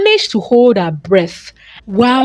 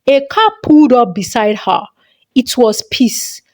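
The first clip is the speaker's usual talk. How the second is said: ordinary delivery, nothing marked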